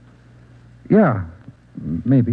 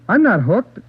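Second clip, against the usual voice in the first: desperate denial; 8 semitones higher than usual. Acted. Addict protests under interrogation.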